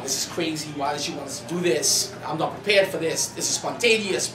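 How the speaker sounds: ordinary talk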